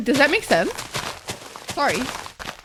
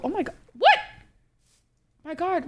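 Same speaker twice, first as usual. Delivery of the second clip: normal talking voice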